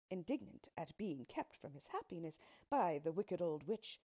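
plain reading voice

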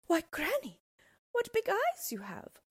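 put-on high little girl's voice, growing uneasy; a small girl wide-eyed with worry. Girl questions granny, growing uneasy.

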